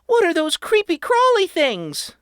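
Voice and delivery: high, loud squeamish cry; alarmed mother. A narrator's reading. Mother recoiling from the crawly things, high and alarmed. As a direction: shrink back from the bugs. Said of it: "Mother exclaimed".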